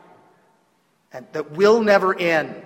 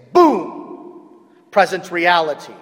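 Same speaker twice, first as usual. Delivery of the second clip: ordinary talk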